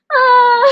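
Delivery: high breaking sob; a choked sob escapes; real crying catch. Real, not acted.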